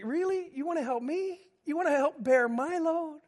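incredulous. Retold or imitated. Grieving man answering the pastor who came to help, in amazed disbelief. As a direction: sound touched and astonished. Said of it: "it's like".

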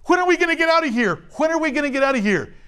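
high and fast, impatient; impatient rush. Retold or imitated. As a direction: blurt it out like a restless captive.

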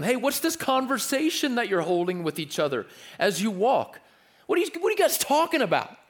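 high, fast friendly question; bright, curious, drawing them out; voicing Jesus. Jesus asking the disciples on the road, high and friendly. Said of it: "And Jesus said to them".

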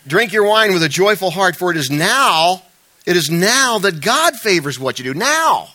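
"now" punched loud and drawn out; emphasis on now. Shown as a demonstration. Preacher reading the verse to the church, stressing "now". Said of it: "I emphasize that word now".

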